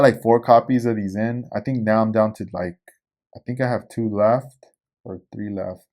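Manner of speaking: usual speaking voice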